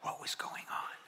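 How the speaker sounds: breathy unvoiced whisper, almost to himself; hushed whisper. Real, not acted. Preacher whispering a question to the congregation, hushed whisper.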